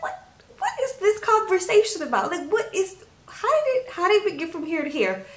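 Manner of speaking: high, bewildered outburst; baffled disbelief. Retold or imitated. Stunned viewer reacting to a pointless TV argument, high and baffled.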